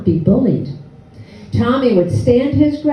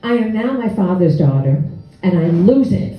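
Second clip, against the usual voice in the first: normal talking voice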